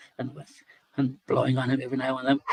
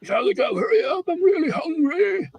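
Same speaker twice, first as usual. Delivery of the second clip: hungry monster; 9 dB louder than usual. A narrator's reading. Witdigo complaining of hunger pains, loud high wail. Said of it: "So he says".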